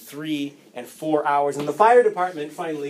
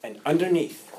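closing sermon; 4 semitones lower, 5 dB quieter than usual. Acted.

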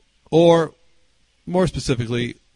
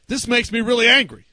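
higher, louder mock indignation; retold anger; 6 semitones higher, 5 dB louder than usual. Angry man confronts the visiting pastor, mock indignant. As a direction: act out a man getting mad. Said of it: "they get mad".

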